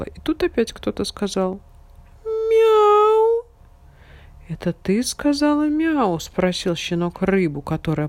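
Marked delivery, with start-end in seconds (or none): 2.2-3.4 cat meow. A narrator's reading. Unknown meows. 4.5-6.2 puppy voice. A narrator's reading. Puppy asks fish.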